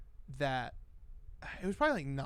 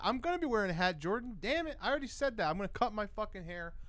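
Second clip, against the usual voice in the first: rising frustration; 6 semitones higher, faster than usual. Real, not acted. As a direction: pitch climbing as the rant heats up. Frustrated rant about haircut.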